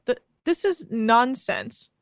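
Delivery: ordinary talk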